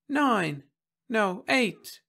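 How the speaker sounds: put-on high child voice; high-pitched boy correcting himself; small boy. A narrator's reading. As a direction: answer like an unsure little kid. Small boy answering the robot.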